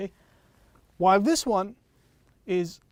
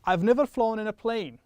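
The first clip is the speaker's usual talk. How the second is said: quick model sentence; 3 semitones higher, 5 dB louder than usual. Shown as a demonstration. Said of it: "I'm going to say this quickly".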